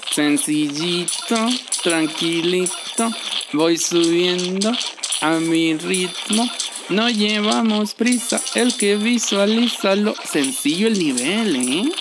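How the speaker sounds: slow, smug relaxed drawl; slow show-off calm; mock calm. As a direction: act totally chill and unhurried. Relaxed mock-calm boasting while playing.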